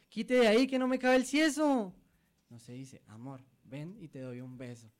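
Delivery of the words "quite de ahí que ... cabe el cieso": high, loud Santander brush-off; pushy Santander accent, pitched high. Pretend. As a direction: bark it in thick Santander slang.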